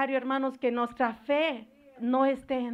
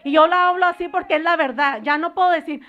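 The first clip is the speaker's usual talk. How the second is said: loud, driving preacher's cry; preaching at full force; 3 semitones higher, 9 dB louder, faster than usual. Acted. Pastor warns the congregation of demons, preaching at full force. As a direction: hammer each line home to the church. Preaching about modest dress.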